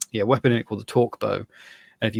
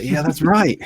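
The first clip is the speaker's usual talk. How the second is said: loud, raised-pitch mock taunt; gloating yell; 6 semitones higher, 5 dB louder, slower than usual. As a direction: crow at a beaten friend upstairs. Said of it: "swear at you from upstairs".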